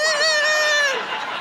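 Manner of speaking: high, loud laughing yelp; flustered yelp. Retold or imitated. Mom caught out in front of daughter, high laughing yelp. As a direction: let out a high embarrassed shriek. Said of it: "And I'm like".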